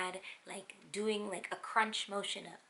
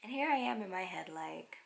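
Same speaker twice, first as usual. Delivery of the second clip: ordinary talk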